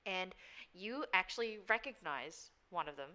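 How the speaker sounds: ordinary delivery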